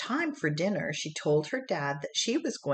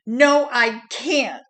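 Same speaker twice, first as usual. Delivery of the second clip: put-on higher, louder, slowed-down child's yell; 8 semitones higher, 11 dB louder, slower than usual. A narrator's reading. Boy yelling at his teacher that he can't, frustrated yell. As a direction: shout it out in frustration. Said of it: "yelled Henry".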